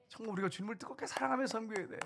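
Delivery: pitched high on 사랑하며, urging; emphatic exhortation. Real, not acted. Pastor urging the congregation to love, pitched high. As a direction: lift the voice to press the call. Preacher urges fervent love.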